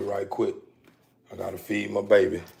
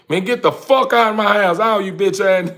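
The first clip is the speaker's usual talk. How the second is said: loud, higher enraged yell; furious shout; 9 semitones higher, 9 dB louder than usual. Retold or imitated. Woman ordering the men out of her house, yelling furiously. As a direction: scream them out the door.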